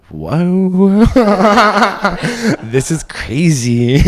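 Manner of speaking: high, loud swoon breaking into laughter; giddy amazement. Acted. Reacting to his date's voice, giddy and laughing. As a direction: draw it out slowly, laughing loudly.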